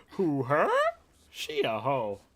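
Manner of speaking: put-on loud, high Vietnamese-accented catchphrase, drawn-out ending; Vietnamese boss impression. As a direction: mimic the Vietnamese manager's loud mock question. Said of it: "funniest shit he would say was like".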